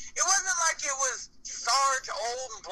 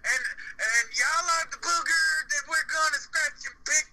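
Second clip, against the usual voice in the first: higher, over-the-top promo voice; mock wrestler promo; 6 semitones higher than usual. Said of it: "he's like".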